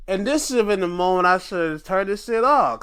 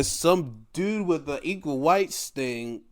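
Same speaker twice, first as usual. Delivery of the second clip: ordinary talk, nothing marked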